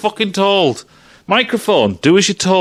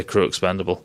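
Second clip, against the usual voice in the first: "crew expendable" said softer, low and held back; hushed anticipation; 10 semitones lower, 7 dB quieter than usual. Retold or imitated. Waiting for the film's famous line, he murmurs it softly. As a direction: murmur the expected line under the breath. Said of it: "I was waiting for it to say".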